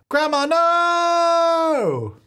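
hugely drawn-out high shout of no; hammed-up grief shout at grandma's death bed; dramatic cry. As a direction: wail it long and high. Grandma dying in game.

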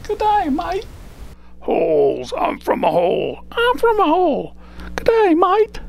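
high, loud, hammed-up Australian puppet voice; bad Australian accent. [name]'s Australian partner greeting everyone, in a high, bad Australian accent. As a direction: squawk out a terrible Australian accent. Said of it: "My Australian partner?".

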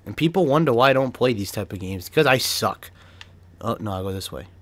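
usual speaking voice

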